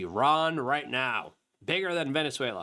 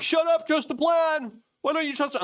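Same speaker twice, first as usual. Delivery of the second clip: mocking loyalists; 5 dB louder than usual. Mimicking loyalists telling him to trust the plan, a high mocking yell. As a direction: parody the fans shouting him down. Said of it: "People are like".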